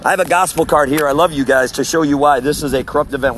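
ordinary talk

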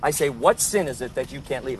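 usual speaking voice

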